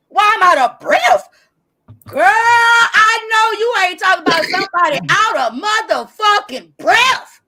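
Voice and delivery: loud, high-pitched outrage; indignant, full-voiced comeback; incredulous shout. Real, not acted. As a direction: holler it in disbelief, stretching the words. Incredulous comeback in a live argument.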